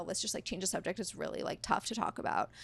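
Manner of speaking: very soft, almost whispered request; retold uneasy plea, trailing into a whisper; hushed plea. Upset date asking to change the subject, hushed plea.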